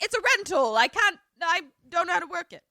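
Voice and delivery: high, loud flustered whine; hammed-up helpless panic at a stuck door; mock panic. Retold panicking idiot at car door.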